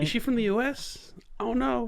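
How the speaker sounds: high, puzzled retelling; mock bewilderment. Retells his puzzled reaction, high.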